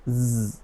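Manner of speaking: জ held as a soft "zzz"; voiced z sound. Shown as a demonstration. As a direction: buzz জ on its own. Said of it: "এস এর sound মাঝে মাঝে".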